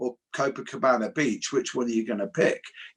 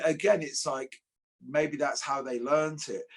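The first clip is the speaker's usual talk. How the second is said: normal talking voice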